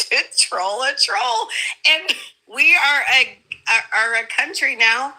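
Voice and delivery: high, laughing gangsta grandma voice; high-pitched exasperated granny rant. Acted. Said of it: "You sound like gangsta grandma said that".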